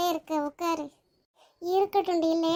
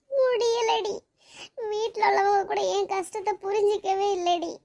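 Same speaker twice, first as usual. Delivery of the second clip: tearful; 3 semitones higher than usual. Acted. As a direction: wail helplessly to comforting friends. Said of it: "சரி, அழாத". (அழுதபடி புலம்பல்)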